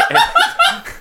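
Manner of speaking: high, bursting cackle; loud laugh. Real, not acted. Game master cracks up at the table, loud laugh. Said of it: "that was the loudest laugh".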